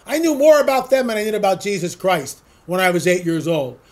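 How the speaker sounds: ordinary talk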